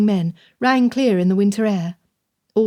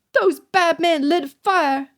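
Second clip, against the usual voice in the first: put-on much higher and slow, a tearful child; sobbing boy; 8 semitones higher, slower than usual. A narrator's reading. Jonah telling Madame of the fire, tearful boy. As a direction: let it break as a tearful lad. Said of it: "he sobbed".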